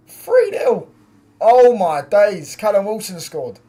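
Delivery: high, loud excited shouting; stunned goal celebration. Real, not acted. Fan reacting to West Ham going three up, excited shouting.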